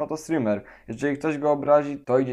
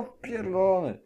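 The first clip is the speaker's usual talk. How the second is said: ordinary talk, nothing marked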